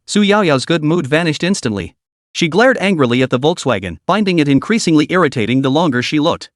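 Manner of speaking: plain reading voice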